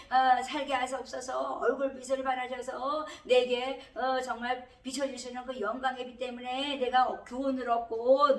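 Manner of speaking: impassioned plea in a high, sustained chant; pleading psalm prayer. Acted. Preacher voicing Asaph's psalm of suffering, pleading prayer. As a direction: cry out to God for rescue, loud and insistent. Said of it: "아삽의 시는 아, 정말 고난당한".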